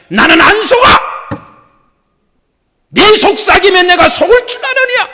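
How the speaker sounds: loud defiant rebuke of Satan; defiant shout. Acted. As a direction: shout back at the tempter. Defying Satan aloud.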